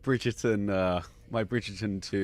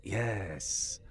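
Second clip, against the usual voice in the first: Gorn voice; 5 dB quieter than usual. Pretend. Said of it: "And just be like".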